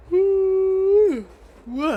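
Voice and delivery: high, loud joyful whoop. Real, not acted. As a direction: let out a big whoop. Sudden whoop of release.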